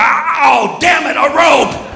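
high, drawn-out mock wail; thwarted shark. Shark foiled by a nylon rope, exasperated shout. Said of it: "he's gonna get the rope and go".

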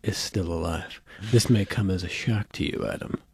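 low, hushed, halting disbelief, played for parody; mock film solemnity. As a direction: imitate a solemn movie hero, very softly. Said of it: "everybody was talking like this".

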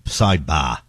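"Sidebar" with no R, Boston style; Boston accent. Retold or imitated. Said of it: "Boston pronunciation of bar".

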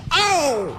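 high, falling "oh"; mock pained cry. Chinese contestant taking another lash, mock pained cry. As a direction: yelp it high and long.

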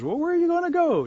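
high, loud, incredulous question; storybook character asking in alarm; puzzled grasshopper. Acted. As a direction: pitch it way up in startled concern. Grasshopper questions the departing bird.

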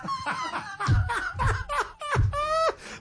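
a long squealing laugh, very high; high burst of laughter. Real, not acted. Host bursting into a high laugh at the boy's club. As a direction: crack up at the rival team's name.

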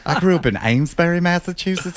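put-on high, foppish Southern-belle accent; teasing imitation of a Southern belle's accent; mock Southern belle accent. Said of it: "sound like a Southern belle".

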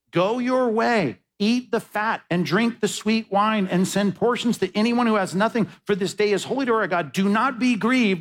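high, ringing proclamation to the crowd; proclaiming command. Retold or imitated. Nehemiah orders the mourning people to stop grieving, in a high ringing proclamation. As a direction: call it out like a prophet stopping the weeping.

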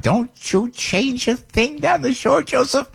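high, slow imitation of his mother; mother's voice. Dying mother warning son about the shore house, high imitated voice. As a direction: lift into Mom's stern voice. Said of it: "my mother told me before she died".

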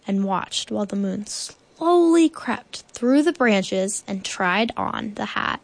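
ordinary delivery

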